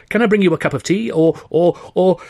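high, fast and flustered; fussing fawner. A narrator's reading. Buxton fawns over Weldon, high and flustered. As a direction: stumble over yourself to please him.

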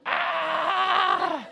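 high, sustained mock scream; pent-up frustration bursting out in a high yell; exasperated scream. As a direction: let out a drawn-out frustrated "aah". Acts out his pent-up exasperation.

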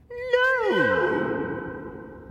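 high, far-off imperious cry; Trixie-like voice. Acted. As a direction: shriek it like Trixie. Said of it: "The Trixie-like second voice echoed".

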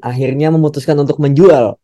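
ordinary talk, nothing marked

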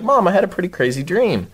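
re-enacted call to mom. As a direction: tell mom, voice lifted high. Calls out to mom in story.

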